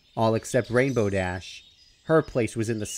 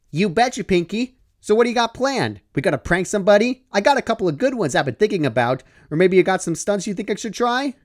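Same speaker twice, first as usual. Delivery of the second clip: raised, eager tomboy rattling off ideas; psyched up; 8 semitones higher, 4 dB louder, faster than usual. Acted. Dash guesses what Pinkie has planned, eager and quick. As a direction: pump yourself up and rattle them off. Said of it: "Dash psyched herself up".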